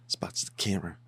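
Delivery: hushed whisper; whispered aside while watching. Real, not acted. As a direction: say it under your breath. Hushed reaction.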